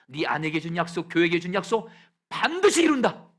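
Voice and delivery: God's promise swelling to a high, loud cry; building promise. Acted. God promising the pastor, swelling into a loud cry. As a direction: build from firm to a ringing declaration.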